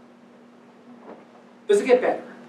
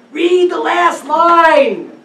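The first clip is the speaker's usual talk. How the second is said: slow loud insistence; 7 semitones higher, 8 dB louder, much slower than usual. Shown as a demonstration.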